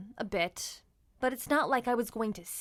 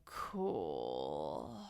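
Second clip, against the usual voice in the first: much lower, slow, awed rumble; deep, drawn-out cool; 9 dB quieter than usual. Acted. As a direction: drop the voice way down in awe. Reacting to high jumper.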